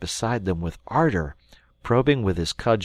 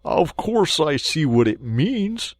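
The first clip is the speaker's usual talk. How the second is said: sceptical Mole; 8 semitones higher, 5 dB louder, slower than usual. Acted.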